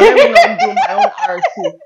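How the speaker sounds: high giggling speech; laughing. Real, not acted. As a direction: laugh through the words. Laughing while speaking.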